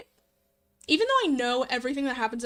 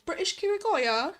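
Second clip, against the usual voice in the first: higher, tentative RP British; attempted British accent; 4 semitones higher than usual. Shown as a demonstration. Streamer testing a character voice for chat, tentative British accent. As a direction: try out a posh RP British voice. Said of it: "like, an R P".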